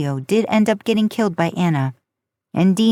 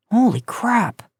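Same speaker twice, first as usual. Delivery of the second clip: lightly through a mouthful of food; mouth full; 3 semitones higher, slower than usual. A narrator's reading. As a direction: talk around a bite. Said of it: "through his full mouth".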